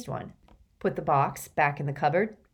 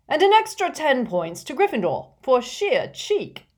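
higher, hearty, amused professor; jolly professor voice; 8 semitones higher, 6 dB louder than usual. A narrator's reading. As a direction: award it with a chuckle. Chuckling professor awarding points.

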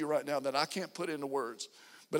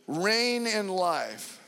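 slow emphasis; 2 semitones higher, 3 dB louder, much slower than usual. Real, not acted. Slow emphatic sermon line.

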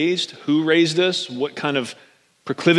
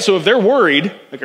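ordinary talk

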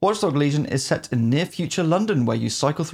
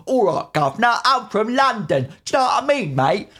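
exaggerated Cockney accent, pitched up; mock Cockney; 8 semitones higher than usual. Mocking every game character's Cockney London accent. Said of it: "all the Cockney accents".

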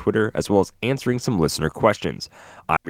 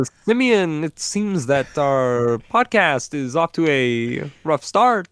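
much higher, slow put-on voice; mock impression voice; 8 semitones higher, slower than usual. Host answers co-host's impression with his own. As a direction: answer his bit with your own goofy voice. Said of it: "we're not doing that".

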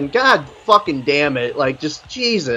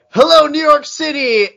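mocking the singer; 10 semitones higher than usual. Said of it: "And then I hear him say".

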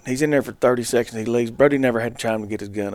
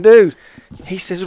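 ordinary talk

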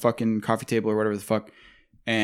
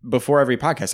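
ordinary talk, nothing marked

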